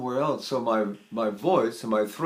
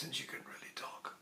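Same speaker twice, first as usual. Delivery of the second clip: imitating a blown-out voice in a whisper; barely-there whispered imitation of voice loss; damaged voice; 22 dB quieter than usual. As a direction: fade to an almost soundless whisper. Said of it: "her voice was like this".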